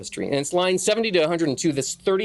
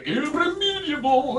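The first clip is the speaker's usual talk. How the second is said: high, stretched-out epic grandeur; mock Miltonic declaiming; 11 semitones higher, slower than usual. Teacher parodying Milton's epic verse, high drawn-out declaiming. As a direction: declaim it like heroic verse, pitch soaring.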